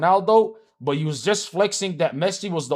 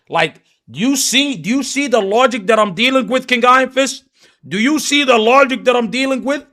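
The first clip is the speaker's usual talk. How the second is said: much louder and higher, ranting in disbelief; exasperated shouting; 7 semitones higher, 8 dB louder than usual. Real, not acted. Streamer yelling at chat over Ronaldo logic, exasperated shout. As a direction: hammer each point at the chat, almost yelling.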